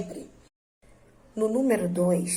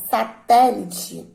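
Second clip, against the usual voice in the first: satélite slowed, with TÉ louder; stress on TÉ; 6 dB louder, much slower than usual. Shown as a demonstration. Teacher showing the antepenultimate syllable, stressed TÉ in satélite. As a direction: punch the TÉ in satélite. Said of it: "observem que o T".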